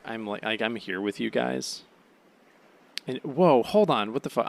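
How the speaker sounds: ordinary talk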